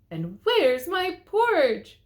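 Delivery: high, loud and slow little bear; Baby Bear crying out. A narrator's reading. Baby Bear missing his porridge, high and wailing. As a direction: whine like a small child. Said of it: "cried Baby Bear".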